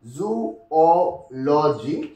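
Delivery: "Zoology" very slow, O set apart as its own syllable; broken into syllables. Shown as a demonstration. Teacher showing O is one syllable, "Zoology" slowly broken up. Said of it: "O also is one sing".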